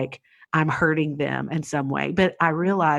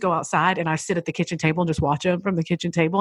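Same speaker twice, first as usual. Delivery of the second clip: ordinary delivery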